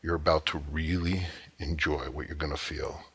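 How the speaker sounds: softened, intimate murmur; whisper in ear. Acted. Man close at a woman's ear, softly confiding. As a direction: drop the volume as if speaking into an ear. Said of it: "I'm gonna whisper in your ear".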